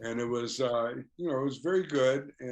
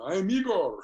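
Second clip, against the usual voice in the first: higher, louder, lightly voiced Russian fan; a hint of the earnest Moscow visitor, pitched up; imitating the Russian fan; 8 semitones higher, 6 dB louder than usual. Fan from Moscow introducing himself to the band, lightly imitated.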